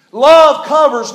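"Love covers" called out loud and slow, LOVE hit hard; loud emphatic point. Real, not acted. Repeats point with force.

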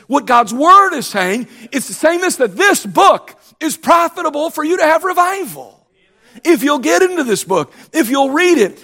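high, loud preaching voice; impassioned preaching. Acted. Pastor urging the congregation to revival, loud and high. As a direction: drive it home to the church.

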